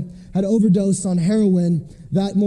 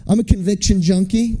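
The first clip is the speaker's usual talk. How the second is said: usual speaking voice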